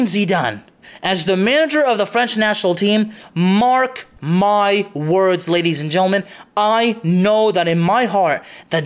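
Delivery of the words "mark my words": slow emphatic vow. Real, not acted. Solemn vow to viewers.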